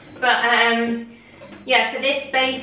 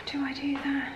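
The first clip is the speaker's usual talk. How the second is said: whispering; 4 semitones higher, 10 dB quieter than usual. Real, not acted. Asking herself aside.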